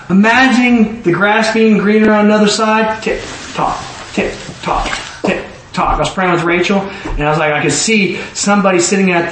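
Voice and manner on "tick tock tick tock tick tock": soft, whispered tick-tock; ticking clock imitation.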